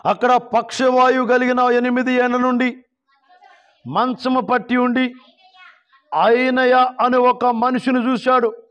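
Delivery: high, sustained, measured Bible reading; scripture proclamation. Real, not acted. Preacher reads the paralysed man's story, high proclaiming voice. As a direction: stretch out each phrase as proclaimed scripture.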